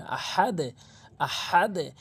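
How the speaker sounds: আহাদে with a bounced final d; correct pronunciation. Shown as a demonstration. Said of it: "আহাদে বলতে হবে".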